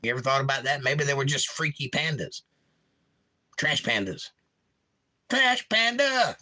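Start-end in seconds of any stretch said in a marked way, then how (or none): 5.3-6.4 high, drawn-out mock holler; mock call-out. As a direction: stretch it out like a playful call. Calls out the animal nickname mockingly.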